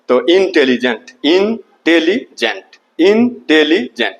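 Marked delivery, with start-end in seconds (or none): 1.2-2.8 higher, slow इं ... tele ... gent; three separate pieces of intelligent, pausing between; broken into syllables. Shown as a demonstration. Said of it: "ये try syllable word है". 3.0-4.2 intelligent in three beats, इं, tele, gent; higher इं, then tele, then gent; broken into syllables. Shown as a demonstration. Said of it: "ये try syllable word है".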